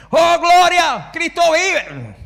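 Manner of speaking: very high, loud, slow exclamation; imitated shout. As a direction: mimic a fervent churchgoer yelling praise. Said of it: "porque no grita todo el tiempo". (imitación de un fiel gritando gloria)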